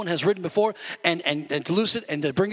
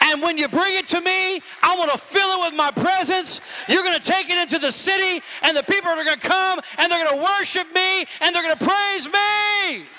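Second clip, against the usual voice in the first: high, loud preaching of God's promise; full-throated pulpit preaching, voice held high; preaching at full force; 9 semitones higher, 3 dB louder than usual. Acted. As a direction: lift it high and loud like a revival climax.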